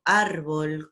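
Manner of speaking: árbol with a strong ÁR; penultimate stress. Shown as a demonstration. Teacher giving examples of graves, first-syllable stress on árbol. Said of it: "suenan fuerte en la anteúltima".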